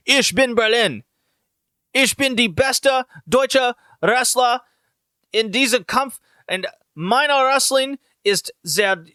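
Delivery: mock Berlin heel speaking German; mock German heel promo. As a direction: play a cocky German villain cutting a promo. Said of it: "he just goes".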